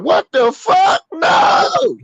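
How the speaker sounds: high comic shriek, drawn out; acted horror. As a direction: cry out in mock terror. Acts out horror as they turn around.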